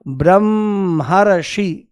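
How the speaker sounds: Brahmarshi said slowly, drawn out; slow reveal. Shown as a demonstration. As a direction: slowly unveil Brahmarshi as the answer. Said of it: "तो शब्द बन जाएगा". (ब्रह्मर्षि धीरे-धीरे खींचकर)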